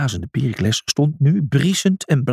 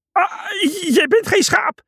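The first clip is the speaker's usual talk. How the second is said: shrieking. Acted. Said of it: "gilde Felix".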